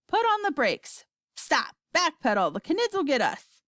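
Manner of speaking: mild shout, bursting out in short commands; shouting grandma. A narrator's reading. Grandma afraid the Knids will get them, shouting. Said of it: "shouted Grandma Josephine".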